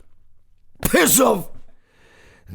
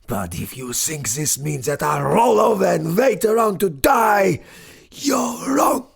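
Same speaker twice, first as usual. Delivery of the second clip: defiant shout. Acted. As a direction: rage back up against the world. Professor rallies in defiant fury.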